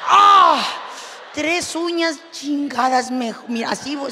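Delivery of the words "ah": grito agudo y fuerte de dolor; grito de dolor imitado. Retold or imitated. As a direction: suelta el grito como si se rompieran las uñas. Uñas rotas contra la pared. (grito de dolor)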